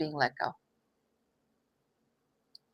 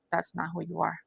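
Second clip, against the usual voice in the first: much softer, gentle repeat; soft reassuring echo; 10 dB quieter than usual. Real, not acted. Reader comforting the viewer, repeating it softly.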